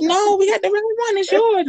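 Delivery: imitating the nurse well above her own voice; a high, sing-song nurse insisting; high mock nurse. Guest mimicking the clinic nurse who insists the test is hers, high mock voice. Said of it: "And she was like".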